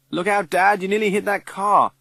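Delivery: high, loud warning cry; alarmed shout. Acted. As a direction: yell it as the car swerves. Warning near crash.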